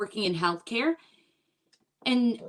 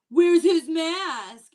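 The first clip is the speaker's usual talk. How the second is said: guys' pickup line; 7 semitones higher, 8 dB louder, much slower than usual. Retold or imitated. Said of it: "They're like".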